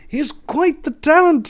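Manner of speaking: put-on high, slow British accent; posh British scout. British scout praising the child, posh accent. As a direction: play a fussy British talent scout. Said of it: "an effeminate British man".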